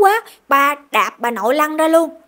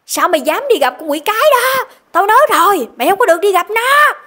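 higher, louder furious yell; father yelling; 8 semitones higher, 6 dB louder than usual. Retold or imitated. Father beats son for visiting his mother, furious yelling. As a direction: roar it while beating the boy. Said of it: "Ba vừa nói vừa gào vừa gằn giọng".

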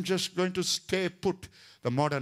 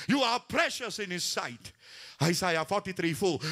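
ordinary talk, nothing marked

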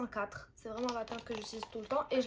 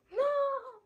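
mock squeal; 14 semitones higher, 4 dB louder than usual. High squealed refusal.

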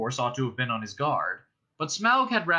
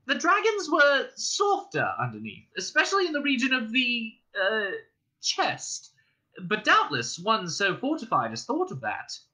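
much higher, timid hobbit squeak; frightened squeak; 10 semitones higher than usual. Acted. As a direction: squeak it out nervously. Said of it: "in a frightened squeak".

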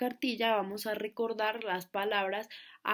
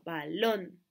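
"balón" lower, weight on LÓN; stress on second syllable; 4 semitones lower than usual. Shown as a demonstration. Teacher showing where the accent falls, "balón" stressed on LÓN. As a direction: land firmly on LÓN in "balón". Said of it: "en la segunda sílaba".

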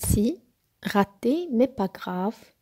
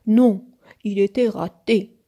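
loud, firm contradiction; emphatic, loud storybook refusal; insistent; 8 dB louder than usual. A narrator's reading. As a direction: insist loudly with a hard NON. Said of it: "insista Nook".

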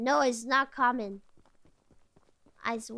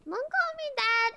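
much higher, pleading protest; whiny protest; 9 semitones higher than usual. Real, not acted. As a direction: whine it up high, offended. Repeats protest to teammate.

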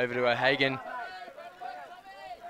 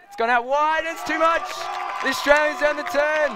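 much higher, loud shouted call; excited shout; 10 semitones higher, 4 dB louder than usual. Real, not acted. Commentator shouts the Australian turnover to viewers, excited. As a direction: yell out the turnover.

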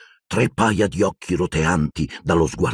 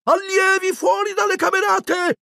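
poltergeist shout. A narrator's reading. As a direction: raise the alarm for the whole castle. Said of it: "cominciò a gridare Peeves". (Peeves grida l'allarme, voce acutissima)

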